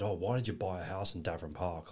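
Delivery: ordinary talk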